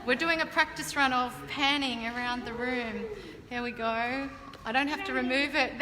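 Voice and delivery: normal talking voice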